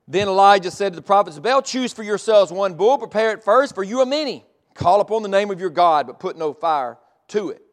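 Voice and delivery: loud, rising preacher's read; scripture reading. Acted. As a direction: proclaim the verse to the hall. Reads scripture aloud with force.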